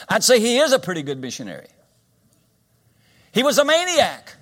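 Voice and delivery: high, full-force pulpit shout; emphatic preaching. Real, not acted. Preacher tells congregation the maniac is now a missionary, shouting emphatically. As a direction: raise it high and loud to drive the point home. Preacher drives home convert's change.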